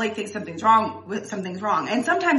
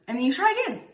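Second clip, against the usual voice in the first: usual speaking voice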